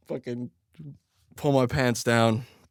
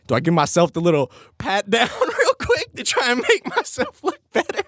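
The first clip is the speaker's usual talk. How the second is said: higher and higher, giggling through it; laughing inner thought; 11 semitones higher than usual. Retold or imitated. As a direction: giggle the sneaky idea out as it climbs. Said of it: "the thought crossed your head".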